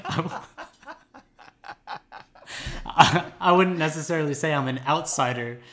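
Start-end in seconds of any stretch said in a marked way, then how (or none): 0.4-2.8 breathless high laugh. Real, not acted. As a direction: burst into helpless laughter. Laughs at own admission.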